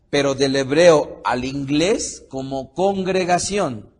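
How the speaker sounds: ordinary talk, nothing marked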